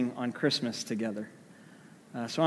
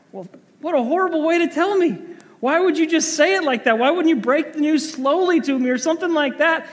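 much higher and louder, indignant complaint; upset wife; 13 semitones higher, 5 dB louder than usual. Retold or imitated. Wife scolding her husband over the phone, high indignant complaint. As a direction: scold him for telling it so bluntly.

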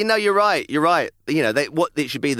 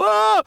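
high, loud mock scream; startled cry; 6 dB louder than usual. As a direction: yelp as if scared awake. Retelling a fright.

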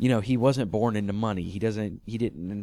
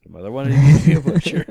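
much louder, fast, put-on voice; mock weary reply; 3 semitones higher, 12 dB louder, faster than usual. Answering Foggy's butcher story, loud and mocking. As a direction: fire it back like a fed-up friend. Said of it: "Yes, Foggy".

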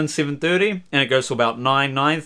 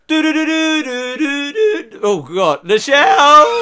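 cracking high on the jingle; 9 semitones higher, 4 dB louder, slower than usual. Pretend. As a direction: let the voice break on the tune. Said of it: "without the voice crack".

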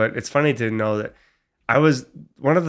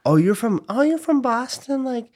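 much higher, mock-curious voice; imitated surprised relatives; 12 semitones higher than usual. Girlfriend's family meeting the Boston boyfriend, mock surprise. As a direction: question it in a high put-on voice.

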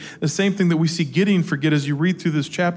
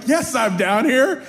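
higher, slow exasperated dad holler; calling back; 7 semitones higher, slower than usual. Retold or imitated. Dad answering his daughter from downstairs, mock-weary shout. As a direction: shout it back up the stairs, stretched out.

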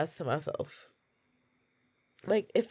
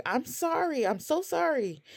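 high, pleading retold apology; tearful apology; 9 semitones higher than usual. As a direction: relive a tearful sorry in a raised pitch. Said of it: "I started crying".

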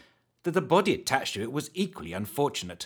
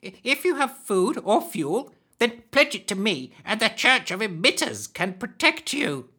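high hag bargaining; 10 semitones higher, 3 dB louder than usual. Acted.

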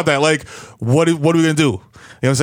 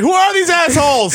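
indignant heckler; 12 semitones higher than usual. Retold or imitated.

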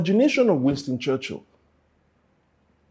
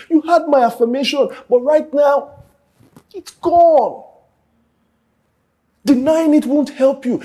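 loud, higher preaching push; full-force preaching; 7 semitones higher, 5 dB louder than usual. Acted. Preacher urging the congregation, loud and higher. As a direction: drive the message home, loud.

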